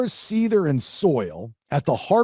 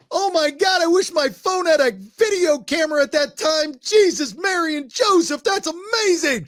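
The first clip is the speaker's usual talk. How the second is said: excited; 14 semitones higher than usual. Retold or imitated. Retelling own excited reaction.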